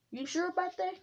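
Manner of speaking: a high, mocking "you sure?" tossed at the boasting god; doubting challenge. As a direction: throw doubt at him sharply.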